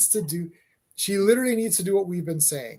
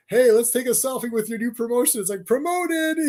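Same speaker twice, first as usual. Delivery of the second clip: much higher, chirpy hammed-up selfie pitch; gleeful mock hype; 5 semitones higher, 4 dB louder than usual. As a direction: sell it like a giddy promo.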